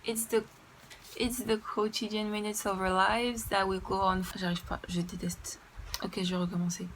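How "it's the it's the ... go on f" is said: halting reading in French-accented English; French accent, tripping over the English. Real, not acted. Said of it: "mon accent anglais".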